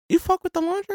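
squeaky disbelieving high question; incredulous. Real, not acted. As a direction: ask it in total disbelief. Incredulous question about lingerie.

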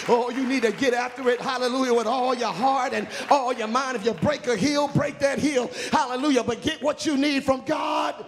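high, driving exhortation; impassioned preacher in full cry; fervent preaching. Real, not acted. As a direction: build to a shouted close, urging the congregation.

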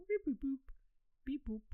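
high droid beeping; robot beeps. Pretend. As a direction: beep like a little droid. Spinning Lego droid head.

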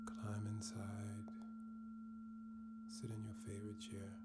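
soft, unhurried meditation voice; guided meditation. Acted. Guiding listeners to let go and breathe, soft meditation voice. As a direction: ease the listener into stillness.

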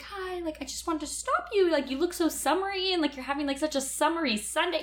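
light high-pitched imitation of a guy; high imitating voice. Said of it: "He also had an accent".

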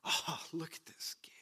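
whispered sneer; mocking whisper. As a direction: hiss it under your breath in scorn.

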